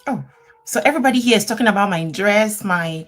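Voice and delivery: usual speaking voice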